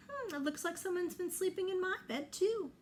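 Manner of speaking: light mother bear echoing papa; Mama Bear voice. Acted.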